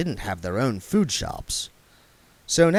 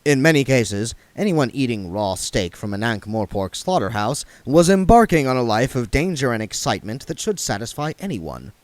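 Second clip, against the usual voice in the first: ordinary delivery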